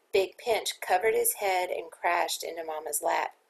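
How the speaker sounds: plain reading voice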